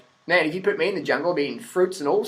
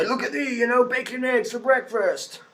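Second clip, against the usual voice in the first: salesman pitch; 6 semitones higher, 3 dB quieter than usual. Retold or imitated. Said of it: "get more cut and go".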